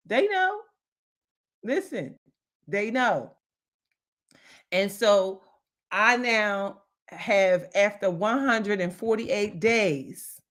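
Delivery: usual speaking voice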